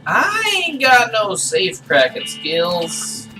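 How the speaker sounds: slow, drawn-out grumble; slow drawl. Real, not acted. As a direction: stretch every word out.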